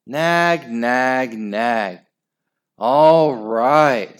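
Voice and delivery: grumbling nag nag; whiny. Acted. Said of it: "said Horrid Henry".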